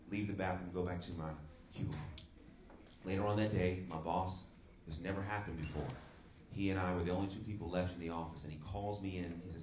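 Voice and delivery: normal talking voice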